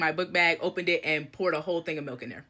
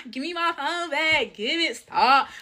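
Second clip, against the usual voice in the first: playful whiny protest; 8 semitones higher, 3 dB louder, slower than usual. Retold or imitated. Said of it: "I'm thinking we doing the little".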